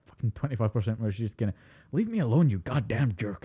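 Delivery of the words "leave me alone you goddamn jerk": mock elf outburst, Scottish accent dropped; high start, imitating a lapsed Scottish accent; slipped Scottish accent. Elf forgetting she is Scottish, Scottish accent slipped. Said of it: "You're supposed to be Scottish".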